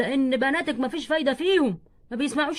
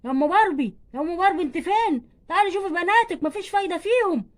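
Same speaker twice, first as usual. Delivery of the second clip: raised, higher scolding voice; angry father shouting; 4 semitones higher, 3 dB louder than usual. Acted. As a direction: call the mother and scold the girls loudly. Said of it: "بتزعق كده ليه". (زعيق أب غاضب)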